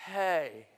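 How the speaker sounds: low, easy sounding of hey; hey sounded alone, falling low; effortless letter hey. Shown as a demonstration. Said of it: "requires a different movement in your mouth, except for one".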